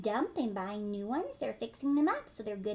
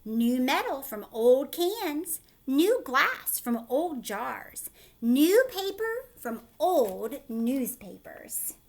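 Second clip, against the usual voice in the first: mayor; 6 dB louder, much slower than usual. A narrator's reading. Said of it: "said the mayor".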